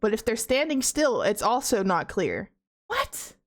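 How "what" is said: startled. Real, not acted. Sudden scare in horror game.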